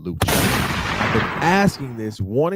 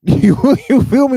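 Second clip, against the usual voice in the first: laughing aside; 13 semitones higher, 10 dB louder than usual. Real, not acted. Laughing mid-retelling.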